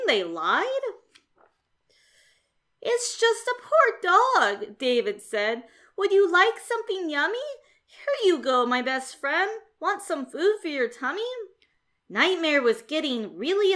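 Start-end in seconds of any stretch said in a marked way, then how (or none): 2.8-4.8 higher, slow, gentle voice for the cat; David, kindly. Acted. David speaks to werewolf. 6.0-11.3 higher, fast, with a laugh; chuckling young cat offering a treat; David, playful. Acted. David offers werewolf food.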